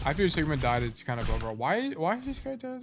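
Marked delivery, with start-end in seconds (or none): 1.5-2.8 surprised. Real, not acted. Reviewing replay, sudden confusion.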